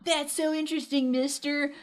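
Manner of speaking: put-on high, starry-eyed kid voice; wholesome 1950s kid. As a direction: gush like an eager little fan. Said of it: "He's like".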